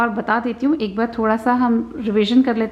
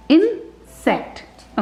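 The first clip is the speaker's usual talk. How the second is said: slow, higher "insect", parted after इन; syllable by syllable; 4 semitones higher, much slower than usual. Shown as a demonstration. Teacher joining sounds for the child, "insect" in slow syllables. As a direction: read इन first, then sect, in "insect". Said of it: "पहले इन read करना है".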